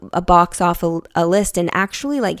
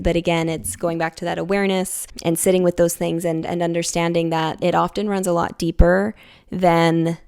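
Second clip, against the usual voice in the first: ordinary delivery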